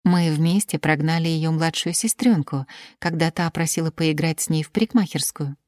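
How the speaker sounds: usual speaking voice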